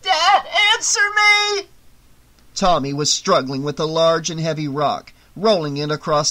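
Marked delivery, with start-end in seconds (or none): 0.0-1.6 much higher, loud desperate cry; frantic yell with a cracking voice; yelling, voice breaking. A narrator's reading. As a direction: scream for her missing father. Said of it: "she yelled, her voice breaking".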